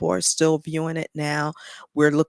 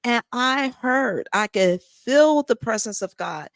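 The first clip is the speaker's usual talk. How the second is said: high, swelling voice full of feeling; moved while testifying, drawing out key words; emotional; 5 semitones higher than usual. Real, not acted. Woman recounting hearing God speak to her, real emotion. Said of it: "I don't know why I get emotional".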